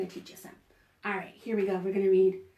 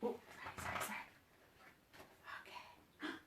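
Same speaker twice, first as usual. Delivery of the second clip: whisper; 17 dB quieter than usual. Real, not acted. Whispered apology aside.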